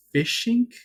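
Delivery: high, questioning echo of an order; a puzzled underling's voice lifting sharply into a question; puzzled query. Acted. Underling questions the boss's order to go fishing, puzzled.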